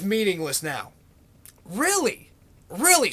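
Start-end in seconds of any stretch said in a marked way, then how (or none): 1.6-3.1 incredulous. Real, not acted. Incredulous at meaningless claim.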